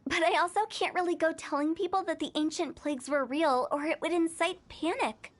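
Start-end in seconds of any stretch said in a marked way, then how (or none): none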